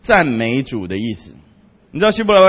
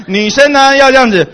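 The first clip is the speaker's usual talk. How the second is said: ordinary talk, nothing marked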